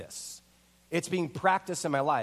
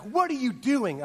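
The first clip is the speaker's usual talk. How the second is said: kinda mad; 6 semitones higher than usual. Retold or imitated. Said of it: "she was kinda mad".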